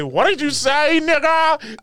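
high, rising mock outrage; hammed-up squealed disbelief; imitating enraged dad. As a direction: mimic the climbing indignant yell. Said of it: "hearing you say".

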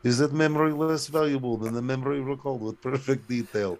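put-on Russian broadcaster accent. Co-host re-reading the riddle, Russian accent. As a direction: keep the thick Russian accent going. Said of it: "on Russian T V".

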